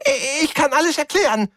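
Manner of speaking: very high, frantic little alien; panicked alien voice. Acted. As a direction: cry out high like a caught stowaway. Caught alien stammers.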